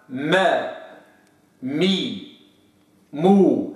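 ما مي مو gliding the m into vowels; wrong vowel slide. Shown as a demonstration. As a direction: show the faulty slipped m. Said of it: "ما جيش أقول مو ولا مي".

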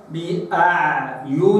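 slow بأعيننا with both noons kept apart; separate each noon. Shown as a demonstration. Quran teacher separating the doubled noons. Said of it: "نخلص نونا من نون".

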